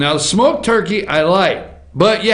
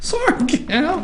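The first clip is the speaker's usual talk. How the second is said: elf voice; 9 semitones higher, 4 dB quieter, slower than usual. Pretend. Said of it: "That is the worst elf impression I've ever heard".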